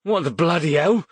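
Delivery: high, loud startled shout; shocked shout. A narrator's reading. Thug shouts in shock at the magic shield, high loud shout. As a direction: cry out in disbelief. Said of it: "one of them shouted".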